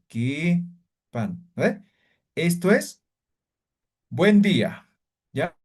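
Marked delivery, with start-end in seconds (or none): 0.1-1.5 "Ki" held long, a short gap, lower "pan"; long vowel in KI. Shown as a demonstration. Teacher showing the long vowel, "Ki" drawn out. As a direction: stretch "Ki" and let "pan" fall. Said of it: "Aquí alargo la vocal".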